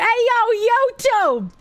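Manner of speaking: Boston street hail, dropped r's; very Bostonian accent. Acted. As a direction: do a broad, hammed-up Bostonian. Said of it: "I read it in a very Bostonian accent".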